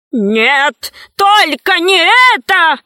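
understated wail of protest, rising high. A narrator's reading. Pygmy begs to keep his riches, wailing. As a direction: wail in protest, pitch jumping up. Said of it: "завопил Вэри Мэри".

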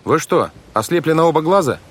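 put-on high, exasperated boy's protest; exasperated outburst. A narrator's reading. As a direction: burst out, unable to hold back any longer. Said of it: "не выдержал Клаус".

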